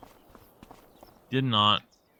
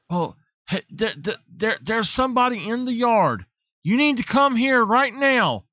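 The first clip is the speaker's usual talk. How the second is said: urgent wife; 8 semitones higher than usual. Retold or imitated. Wife urging him to come.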